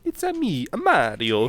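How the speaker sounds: high Italian Mario catchphrase; chirpy plumber voice. Pretend.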